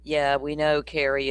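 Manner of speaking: usual speaking voice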